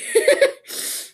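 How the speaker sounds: a high, breathy laugh at herself; embarrassed laugh. Real, not acted. Sick vlogger laughing after coughing, embarrassed laugh.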